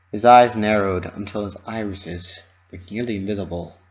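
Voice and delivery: ordinary delivery, nothing marked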